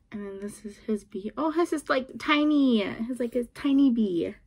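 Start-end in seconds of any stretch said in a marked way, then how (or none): 0.1-1.3 hushed aside to the camera; whisper. Real, not acted. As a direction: whisper it like a secret. Whispering card details aside.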